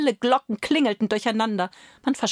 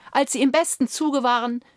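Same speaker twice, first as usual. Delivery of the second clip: usual speaking voice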